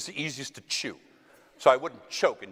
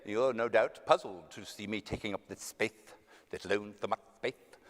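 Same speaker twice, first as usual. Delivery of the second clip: Chesterton's English accent; 3 semitones lower, 5 dB quieter than usual. Shown as a demonstration. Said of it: "So when I say".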